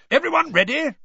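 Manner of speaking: bursting with eagerness; excited. A narrator's reading. Excited Bagman voiced.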